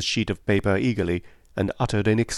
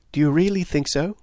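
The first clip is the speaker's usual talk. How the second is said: high, pleased question; undisguised pleasure; 7 semitones higher than usual. A narrator's reading. Friend welcoming Poirot's opinion, openly pleased. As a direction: ask with pleasure showing through. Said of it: "I could not disguise my pleasure".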